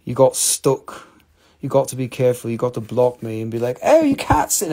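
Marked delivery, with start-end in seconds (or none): 3.8-4.7 high, loud mock objection; mock protest. Acts out a viewer's objection.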